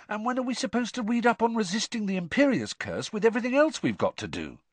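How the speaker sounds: high, fast grumbling question; exasperated complaint. A narrator's reading. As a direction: rush it out in protest. Ron complaining to Harry.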